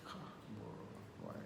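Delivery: breathy, hushed lament; sad whisper. Retold or imitated. Boyfriend recalling sad reaction to girlfriend, hushed lament. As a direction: whisper it, sadly deflated. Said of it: "I was like".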